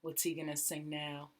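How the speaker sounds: usual speaking voice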